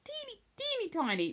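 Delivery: high doting coo. Real, not acted. Cooing over tiny pet.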